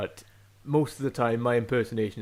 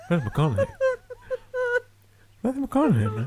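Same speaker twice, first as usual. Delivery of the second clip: a louder, higher drawled McConaughey; 6 dB louder, faster than usual. Retold or imitated. A rough McConaughey impression for his podcast co-host, louder and higher. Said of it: "Nothing like him".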